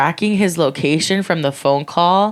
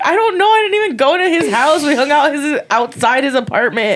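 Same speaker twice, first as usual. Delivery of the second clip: panicked; 8 semitones higher than usual. Retold or imitated. Retold panic to police.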